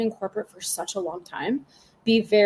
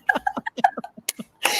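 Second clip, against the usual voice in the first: a breathy high laugh that bursts out; high burst of laughter. Real, not acted. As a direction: crack up at your own story. Laughing at her own story.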